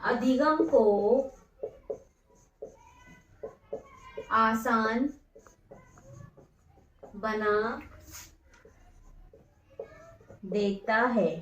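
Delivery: slow dictation. Shown as a demonstration. Teacher dictating exam notes to students, slow word-by-word dictation. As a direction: dictate each word slowly so students can write.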